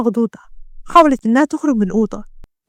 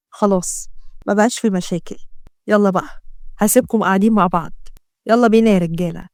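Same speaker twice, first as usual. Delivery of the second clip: put-on lower, jovial old pasha; old mafia boss; 3 semitones lower than usual. Pasha congratulates his son on marrying the doctor, lower and jovial. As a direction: tease the son fondly.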